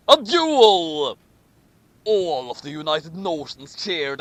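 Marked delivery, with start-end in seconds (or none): 0.1-1.2 dramatic declaration. Acted. Obama declaring a duel.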